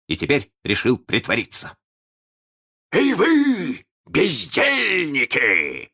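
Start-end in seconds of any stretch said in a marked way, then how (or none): 2.9-5.9 high, slow pirate captain shout; Captain Hook voice. Pretend. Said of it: "закричал он голосом капитана Крюка".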